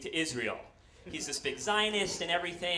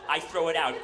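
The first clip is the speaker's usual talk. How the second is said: usual speaking voice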